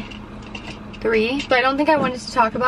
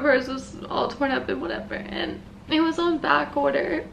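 tearful; 4 semitones higher, 5 dB quieter than usual. Real, not acted. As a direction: voice tight and tearful, fighting back tears. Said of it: "I'm gonna cry again".

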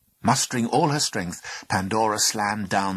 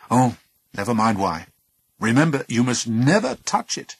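sharp and louder; 3 semitones higher, 6 dB louder than usual. Acted. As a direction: snap it out as a warning. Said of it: "Epimetheus would reply sharply".

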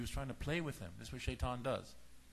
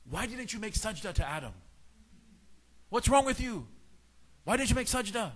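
high, raised-voice demand; indignant interrogation; 8 semitones higher, 4 dB louder than usual. Retold or imitated. Al-Junaid questioning Shaitan about sajda, loud indignation. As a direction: confront him in outrage. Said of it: "he composes himself and he says".

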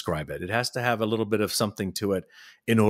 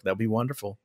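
usual speaking voice

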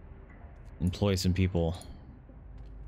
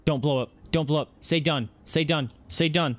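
higher nervous begging; higher, anxious chant of please work; anxious pleading; 7 semitones higher than usual. Real, not acted. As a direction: plead with the machine. Begging generator not to explode.

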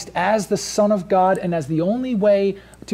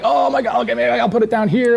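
higher, louder panicked blurt; mock freak-out; 6 semitones higher, 4 dB louder than usual. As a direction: act out a flustered panic over a botched job. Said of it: "I'm freaking out".

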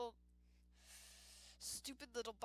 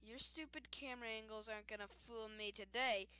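usual speaking voice

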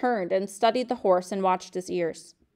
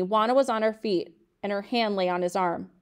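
ordinary delivery

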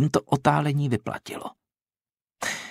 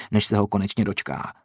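ordinary delivery, nothing marked

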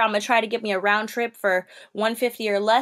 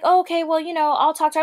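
flustered agent; 6 semitones higher, 3 dB louder, faster than usual. Retold or imitated. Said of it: "So Monica's like".

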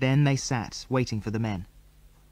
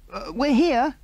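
yelled; 10 semitones higher, 3 dB louder, slower than usual. A narrator's reading. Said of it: "yelled Mary valiantly".

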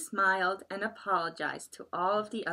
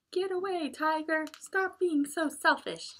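high little-animal shout; small animals driving off the tiger; 8 semitones higher than usual. Acted. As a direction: chase him off, squeaky.

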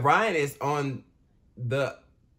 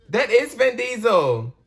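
higher, louder and slower, triumphant; excited; 10 semitones higher, 8 dB louder, slower than usual. Real, not acted. Spotting the actor at last, triumphant and louder. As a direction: savour being right.

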